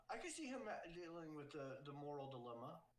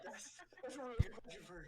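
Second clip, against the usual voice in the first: eager gush; 9 semitones higher, 6 dB louder, faster than usual. Retold or imitated. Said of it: "I was like".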